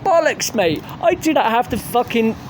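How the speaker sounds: high, worked-up scoffing; indignant rant. Real, not acted. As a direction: scoff at the claim in disbelief. Ranting at singer's claim.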